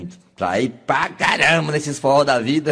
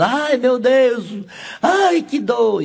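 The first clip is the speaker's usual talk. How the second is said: high, slow drawn-out cries of pain; screaming woman in labor; 8 semitones higher, much slower than usual. Acted. Woman in labor crying out to God, high drawn-out wail. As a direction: wail it out like a woman giving birth.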